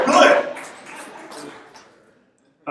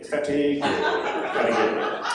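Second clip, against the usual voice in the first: usual speaking voice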